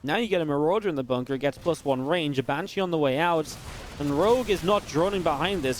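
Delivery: normal talking voice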